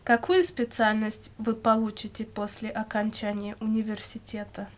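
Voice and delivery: ordinary talk, nothing marked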